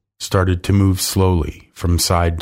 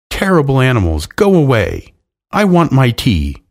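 higher, faintly raised indignant cry; mild narrated shout; 5 semitones higher, 3 dB louder than usual. A narrator's reading. Old ladies shouting at the animals from their cage, mildly shouted. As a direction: call out crossly, without full volume. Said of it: "shouting at the animals".

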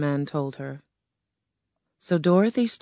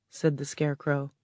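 ordinary delivery, nothing marked

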